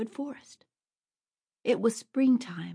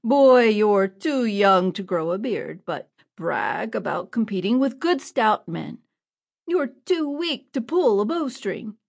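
a rough man jeering loudly; second bandit taunting, louder; mocking outlaw; 6 dB louder than usual. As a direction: laugh down at the boy's boasting. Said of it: "Another man said".

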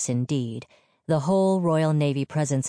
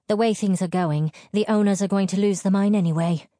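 blunt, higher and quickening; raised-pitch bluntness; 6 semitones higher, 4 dB louder, faster than usual. A narrator's reading. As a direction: say it bluntly, pushing the point. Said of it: "she said bluntly".

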